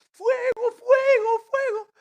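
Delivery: put-on boy yelling fire in a smoke-filled house; panicked shout. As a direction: scream it in a blind panic. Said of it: "Rubén estaba así".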